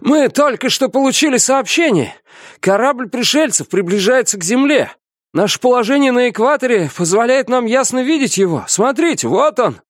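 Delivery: high-pitched, urgent calling out; breathless shouted report. A narrator's reading. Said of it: "крикнул капитану, задыхаясь".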